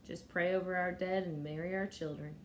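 usual speaking voice

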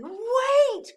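high, loud shout to stop; excited storybook yell; sudden cry. A narrator's reading. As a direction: cry out urgently. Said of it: "cried Flip".

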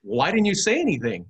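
high-pitched, re-enacted disbelief; astonished protest. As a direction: demand it in retold astonishment. Retold astonished reply to priest.

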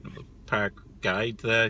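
normal talking voice

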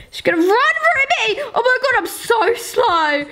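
high, urgent cry while fleeing; playful panic. Real, not acted. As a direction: urge yourself on, about to be caught. About to be caught while fleeing.

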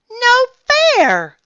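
very high, loud protest; loud complaint. A narrator's reading. Ian turned away from the hotel, loud complaint. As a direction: wail it like a left-out kid. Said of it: "complained Ian".

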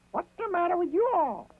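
high, perky rabbit voice; a lively little rabbit, curious; Brer Rabbit. A narrator's reading. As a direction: Brer Rabbit asking the hungry animals.

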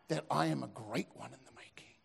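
whispered self-flattery; mock whisper. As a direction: breathe it like a secret about your greatness. Mock inner thought of proud apprentice.

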